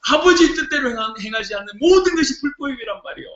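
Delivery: fiery preaching. Acted. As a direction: hammer each line home.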